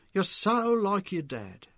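a proud mother speaking slowly; mother's voice. A narrator's reading. Mother praising her son after a game, slow and fond.

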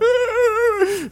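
high gasping yelp at the mirror; a sudden startled shriek; shocked cry. Retold or imitated. Seeing yourself in the mirror after crying, a high shocked yelp. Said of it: "you're like".

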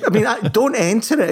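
laughing while defending himself; words tumbling out through laughter. Real, not acted. To co-host who mocked him, laughing it off. Laughing at being called sad.

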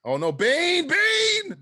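play-panicked shout, climbing on the repeat; mock calling out. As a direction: wail the name in mock alarm.

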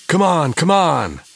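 loud, high, slow urgent yell; shouting gangster. A narrator's reading. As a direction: bark at the gang to hurry. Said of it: "That was Tony's voice".

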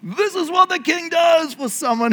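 high proclamation, easing toward the end; official's shout. Acted. Officials leading the honored man through the square, proclaiming. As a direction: call it out like a hammed-up herald. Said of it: "have the officials shout as they go".

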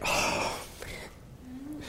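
breathy, near-whispered, guilty; sheepish groan. Retold or imitated. Guilty friend caught out over the stabbing, hushed and sheepish. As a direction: mutter it like you got caught. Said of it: "Chris was just like".

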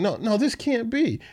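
high, pained disbelief; pleading. Acted. Jeremiah pleading with the Lord in high disbelief. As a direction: plead that it cannot be. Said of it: "Jeremiah is pleading".

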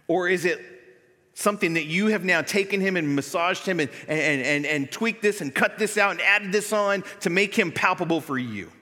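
loud, driving sermon voice; fiery preaching. Acted. Pastor urging the church to leave the world, loud preaching. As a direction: preach it hard to the congregation.

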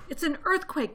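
high, loud cry of alarm. A narrator's reading. Missus Fox to her family underground, crying out. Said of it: "cried Missus Fox".

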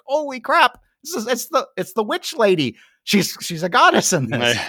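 surprised. Retold or imitated. Recognising actress from movie.

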